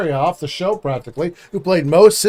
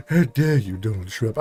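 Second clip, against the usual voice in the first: low, hushed mock English accent; 9 semitones lower, 4 dB quieter than usual. Host imagining him English, mock English accent. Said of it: "if he was English".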